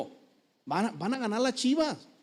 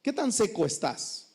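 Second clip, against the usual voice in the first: ordinary talk, nothing marked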